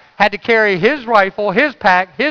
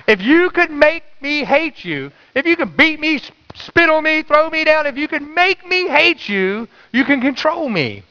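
preaching; 8 semitones higher than usual. Acted. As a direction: pound each line to the church, pitched up. Preaching to congregation.

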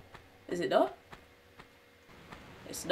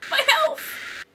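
delighted cry; 3 dB louder than usual. Real, not acted. Health restored, delighted.

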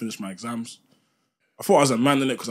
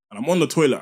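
normal talking voice

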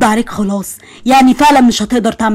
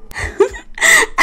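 high delighted giggle; 4 dB quieter than usual. Acted. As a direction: burst into a happy giggle. Happy laugh. (ضحكة فرحانة عالية)

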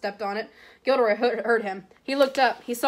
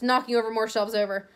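ordinary delivery, nothing marked

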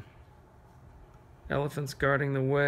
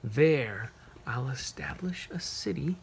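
lilting, higher poem reading, the last line hushed to a whisper; reading a poem to children; 5 semitones higher than usual. Acted. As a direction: read it like a bedtime verse for little ones.

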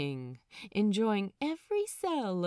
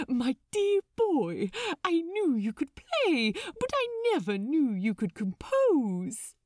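higher and louder, gushing with delight; enthusiastic; 6 semitones higher, 4 dB louder than usual. Acted. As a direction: beam with pride at your friend. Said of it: "pink in the cheeks with his enthusiasm".

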